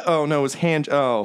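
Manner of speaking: usual speaking voice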